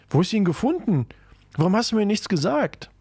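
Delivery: high, agitated questions; alarmed questioning. Acted. Boyfriend learns girlfriend had his dog, high alarmed questioning.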